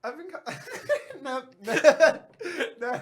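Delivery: high giggling, can't get the words out; cracking up mid line; real laughing fit; breaking into laughter. Real, not acted. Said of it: "Can't do it".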